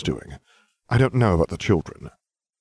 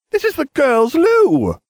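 put-on a much higher, louder, slow boy's protest; 7 dB louder than usual. A narrator's reading. Ron protesting about the girls' loo, high and loud. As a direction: object in shocked indignation. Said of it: "the Weasley protested".

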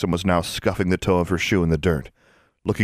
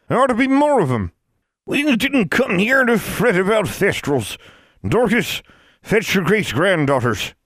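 put-on commanding old crone; 13 semitones higher, 4 dB louder than usual. A narrator's reading. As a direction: bark orders like an old witch. Eldest witch commanding.

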